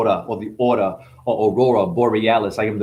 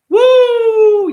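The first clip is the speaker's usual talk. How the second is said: hype shout; 10 dB louder than usual. Real, not acted. Hype shout mid-freestyle.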